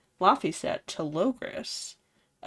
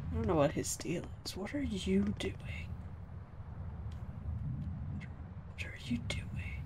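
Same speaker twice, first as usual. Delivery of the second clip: whisper; 15 dB quieter than usual. Real, not acted. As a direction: whisper it so nobody hears. Whispering at the screen.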